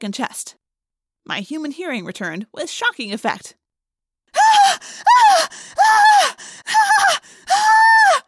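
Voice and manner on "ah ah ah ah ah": piercing high screams, full force; uncontrolled screaming. A narrator's reading. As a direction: scream in raw panic. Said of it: "Someone was screaming uncontrollably".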